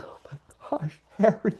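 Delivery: moved almost to tears by the line; overcome with emotion. Real, not acted. Overwhelmed reacting to a moving line.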